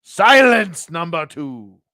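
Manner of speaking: loud, high-pitched burst of command; commanding master. Acted. Man silencing his wallet, commanding. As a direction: cut the servant off loudly. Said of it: "man looks back at the wallet and says".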